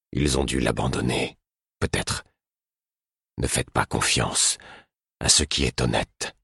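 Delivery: a hint of a grave old king. A narrator's reading. King Verity voiced in narration.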